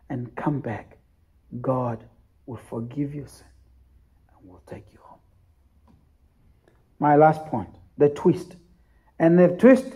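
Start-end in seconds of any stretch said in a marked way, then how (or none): 0.1-5.2 fading to a whisper. Acted. Preacher's invitation fades out.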